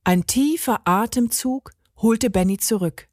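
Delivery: usual speaking voice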